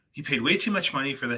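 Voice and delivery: ordinary talk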